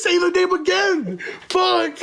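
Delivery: high embarrassed laugh; laughing at himself. Real, not acted. Caught naming the store, laughing. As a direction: crack up at his own slip.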